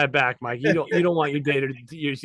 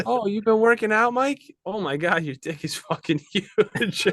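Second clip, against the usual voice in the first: higher-pitched impressed gushing, breaking into a laugh; mock admiring surprise; 7 semitones higher than usual. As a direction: act amazed and flattering, hammed-up. Acted admirer in an imagined scene.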